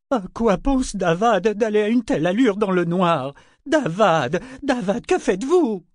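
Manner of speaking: high-pitched, agitated protest; alarmed exclamation. A narrator's reading. Ronica protests the coach racing through the dark, exclaiming. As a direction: cry out to the driver in alarm. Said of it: "Ronica s'exclama".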